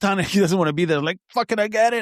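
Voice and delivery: high-pitched, agitated retelling; worked-up outburst. Retold or imitated. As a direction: blurt it high and exasperated. Acting out his gym outburst.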